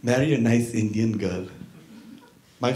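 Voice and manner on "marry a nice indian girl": put-on low, Indian-accented grandmotherly advice; lower-pitched grandma voice, Indian accent. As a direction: advise gently in an Indian accent. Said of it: "That's what she said".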